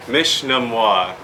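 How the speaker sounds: Mishnamwa drawn out slowly, syllable by syllable; slow and clear. Shown as a demonstration. Greeting a student by name, slow Mishnamwa. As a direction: stretch Mishnamwa out for a learner. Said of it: "we'll go to start with you".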